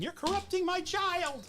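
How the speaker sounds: high, slow indignant complaining mother; Karen mom voice. Retold or imitated. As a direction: whine it like an outraged suburban mom. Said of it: "some Karen mom ... saying".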